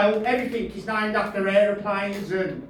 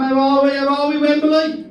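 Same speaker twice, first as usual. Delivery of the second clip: loud, raised stage greeting; big, punchy rock-gig hello; calling to the crowd; 5 semitones higher, 6 dB louder than usual. Real, not acted. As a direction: hail the audience like a stadium crowd. Comic greets crowd on taking stage.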